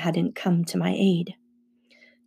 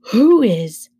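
loud, frightened cry; alarmed question; 3 semitones higher, 7 dB louder than usual. A narrator's reading. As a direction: blurt it out in alarm. Frightened boy sees the horned figure.

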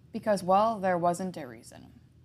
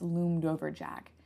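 plain reading voice

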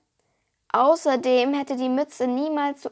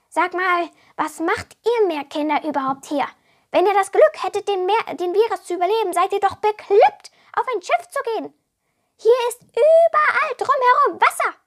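high, loud little kobold voice; boastful kobold; 5 semitones higher, 5 dB louder than usual. A narrator's reading. Kobold boasting and complaining to Alea, high and loud. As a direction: brag like a puffed-up goblin.

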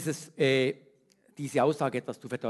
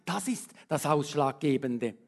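usual speaking voice

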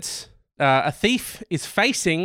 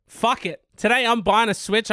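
normal talking voice

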